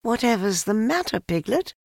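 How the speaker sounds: put-on high, light child's question; boy's voice. A narrator's reading. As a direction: ask as a puzzled little boy. Narrator voices boy Christopher Robin.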